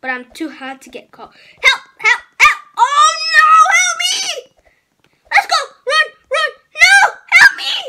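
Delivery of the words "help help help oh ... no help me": being chased. Real, not acted.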